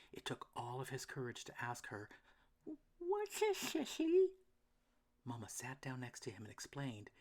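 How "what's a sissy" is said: high, slow little duckling voice; a small worried duck, pitched up. A narrator's reading. Elmer asks Mama.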